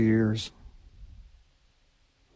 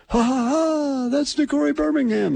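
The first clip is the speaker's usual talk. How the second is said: Keith Jackson impression; 13 semitones higher than usual. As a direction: do the old announcer calling the catch. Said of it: "That was Keith Jackson saying that".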